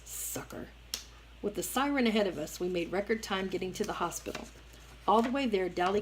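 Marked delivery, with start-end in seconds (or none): 0.0-0.7 hissed under the breath. A narrator's reading. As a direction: hiss it, barely voiced. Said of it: "I heard Dally hiss".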